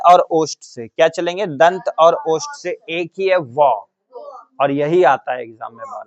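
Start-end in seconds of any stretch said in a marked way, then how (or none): none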